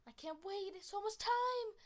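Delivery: high, loud, bursting with eagerness; eager purr. A narrator's reading. Kit eager to explore the territory, high excited squeal. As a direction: squeal it out, can't wait any longer. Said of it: "Alpine Kit purred".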